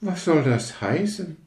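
empörte Rakete. Acted.

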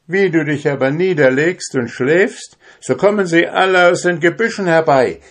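high, quick, bold voice spinning a tale; cunning drummer. A narrator's reading. Little drummer scares the giant with tales of tiny warriors, high and bold. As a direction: spin the lie with eager bravado.

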